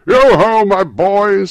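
high, very loud hearty call; rally the lads merrily; booming, cheerful boss; jovial holler. A narrator's reading. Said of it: "said Fezziwig".

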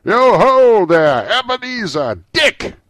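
big, high, drawn-out holler; rich, fat, jovial voice calling out loudly. A narrator's reading. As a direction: summon the apprentices with a hearty bellow. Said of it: "called out in a comfortable, oily, rich, fat, jovial voice".

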